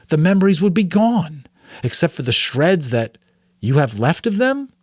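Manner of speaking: put-on a boy spinning anxious what-ifs; young Jonas voice.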